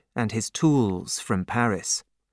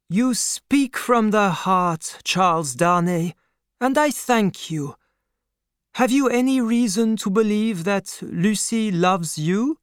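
put-on moved old doctor; 8 semitones higher than usual. A narrator's reading.

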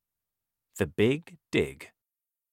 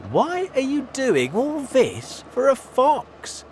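much higher, teasing; mocking villagers; 7 dB louder than usual. A narrator's reading. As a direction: scoff at the farmers. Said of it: "the people asked".

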